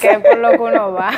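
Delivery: chuckling through the words; amused, laughing aside. Real, not acted. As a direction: laugh as you say it. Both laugh about sweets.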